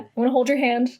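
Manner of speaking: normal talking voice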